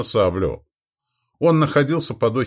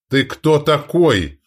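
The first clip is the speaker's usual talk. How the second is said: louder, higher, drawn-out irritated challenge; irritated exclamation; 6 semitones higher, 5 dB louder, much slower than usual. A narrator's reading. Duke confronting the gaunt king, irritated. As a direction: exclaim it slowly and crossly. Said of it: "раздражённо воскликнул Андрей Васильевич".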